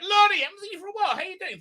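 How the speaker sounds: very high, squeaky-fast hello; rushed greeting. Real, not acted. Streamer hurrying through chat greetings, high and rushed.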